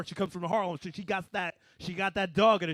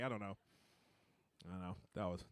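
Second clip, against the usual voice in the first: ordinary delivery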